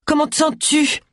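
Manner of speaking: high-pitched eager visitor; high, keen character voice; Roy Silver. A narrator's reading. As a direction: ask in a put-on high voice. Roy's high voice continues.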